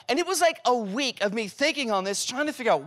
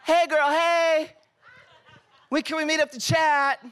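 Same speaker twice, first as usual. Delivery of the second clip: hammed-up friend text; 8 semitones higher than usual. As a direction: stretch it out like a sugary text. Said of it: "He's like".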